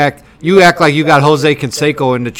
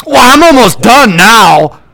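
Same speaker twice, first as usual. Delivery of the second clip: slow, drawn-out snide doctor; mocking the doctor; 6 semitones higher, 7 dB louder than usual. Patient mimicking the vasectomy doctor's excuse, snide and slow. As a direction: sneer his excuse back slowly.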